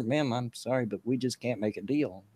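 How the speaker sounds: soft, hushed and kind; quiet apology. Retold or imitated. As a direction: gently let her down. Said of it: "quietly told her".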